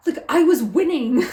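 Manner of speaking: high, loud, petulant complaint; hammed-up sore fighter; mock indignant protest. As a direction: protest as if robbed of a win. Retells Will's protest at interruption.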